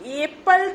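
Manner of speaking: एप्पल with a long 'ay' at the start; letter-name mispronunciation. Shown as a demonstration. Phonics teacher shows what we don't say, 'AY-pal' for एप्पल. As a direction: say एप्पल wrongly as 'AY-pal', high and emphatic. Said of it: "एप्पल तो नहीं बोल रहे".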